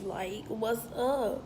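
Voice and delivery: ordinary delivery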